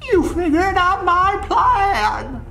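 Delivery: very high, drawn-out clown villain cry; Ronald McDonald. Acted. Vlogger imagines Ronald coming out, in a high stretched shriek. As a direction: squeal it slowly and loudly like an unmasked schemer. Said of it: "Ronald himself comes out and he's like".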